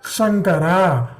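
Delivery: Shankara drawn out slowly, palatal sha; teacher modelling Shankara slowly; slow demo. Shown as a demonstration. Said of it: "The middle of the tongue goes up".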